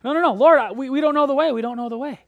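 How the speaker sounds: loud, high mock freak-out; retold panic. Thomas freaking out to the Lord, panicking. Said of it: "starts kinda panicking, starts freaking out and saying".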